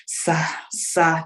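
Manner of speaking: stammering on the first syllable; halting, broken attempts at an unfamiliar name; imitated stuttering. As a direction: get stuck on the opening sound. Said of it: "She started stuttering".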